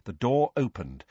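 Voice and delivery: usual speaking voice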